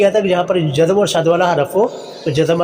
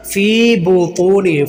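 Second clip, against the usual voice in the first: फ़ी बुतूनिहिम with fee and too drawn out; slow recitation of फ़ी बुतूनिहिम, holding fee; lengthened fa; slower than usual. Shown as a demonstration. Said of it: "फ़ा को लंबा करेंगे". (फ़ी बुतूनिहिम में 'फ़ी' लंबा)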